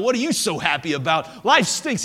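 put-on loud, frustrated little-boy retort, pitch jumping up; acted exasperated outburst. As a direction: take it out on the dog, loudly. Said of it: "that little boy was so frustrated".

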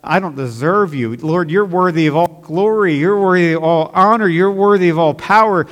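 high, loud, pleading praise to God; fervent loud prayer. Retold or imitated. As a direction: cry out to God with all you have. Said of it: "I come to him in this time and say".

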